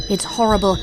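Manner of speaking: usual speaking voice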